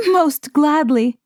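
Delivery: put-on a touch of joyful girl, slow; glad reply. A narrator's reading. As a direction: accept him slowly and gladly. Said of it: "she replied".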